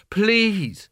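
pleading. A narrator's reading. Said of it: "she said".

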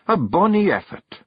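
high Scots praise; praising Kelda. Acted. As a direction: encourage him. Jeanie's voice.

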